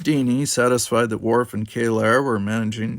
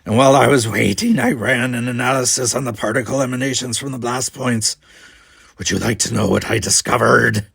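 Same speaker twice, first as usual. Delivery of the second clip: defiant Klingon; 8 dB louder than usual. Acted.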